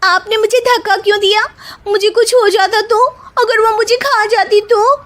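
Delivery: high, fast indignant protest; hurt protest, rushed; aggrieved complaint. Acted. Upset after being pushed.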